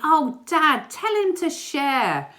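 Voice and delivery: loud, high, drawn-out whine to Dad; complaining kids. Retold or imitated. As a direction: call back down like a squabbling child. Kids calling back to dad.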